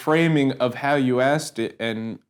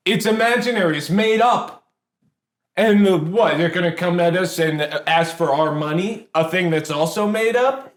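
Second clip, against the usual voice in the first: higher-pitched, indignant telling-off; indignant rebuke; 5 semitones higher than usual. Acted. Interviewee scolding an unprepared interviewer, indignant rebuke.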